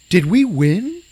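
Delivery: mock groggy question. Friend retelling a movie, voicing the waking man. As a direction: ask it like a man just out of a coma.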